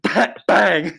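playful exploding-rocket bang; mock explosion.

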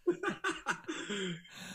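high amused laugh; real laugh. Real, not acted. Guest laughing at host's travel nerves, high laugh. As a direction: laugh warmly at the host's fear of distance.

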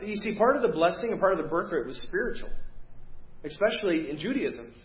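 normal talking voice

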